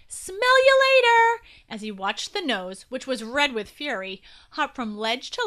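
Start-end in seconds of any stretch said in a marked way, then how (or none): 0.1-1.4 high, drawn-out, loud yell; taunting yell. A narrator's reading. Will scaling the rock wall, yelling at the nose. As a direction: yell a cheeky farewell from afar, slowly. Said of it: "Will yelled".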